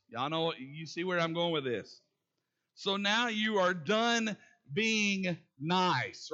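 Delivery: ordinary talk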